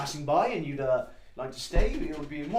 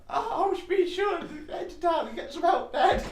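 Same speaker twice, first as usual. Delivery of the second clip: high Cockney lad, half laughing; Cockney boy. Acted. Boy answers his father in a high laughing Cockney voice. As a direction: call back to Dad in Cockney. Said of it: "I talk like a Cockney".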